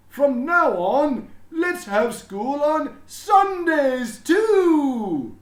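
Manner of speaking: high, loud and slow, booming with pride; a beaming principal proclaiming to everyone; proud headmaster announcing. A narrator's reading. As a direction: announce it grandly to the whole school. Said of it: "Mister Keene announced".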